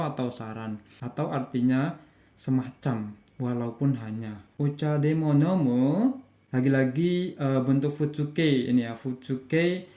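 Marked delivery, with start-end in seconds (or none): 4.6-6.1 casual question with "nomu" rising at the end; intonasi naik. Shown as a demonstration. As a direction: turn it into a question by lifting "nomu". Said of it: "intonasinya naik". (nada naik pada "nomu")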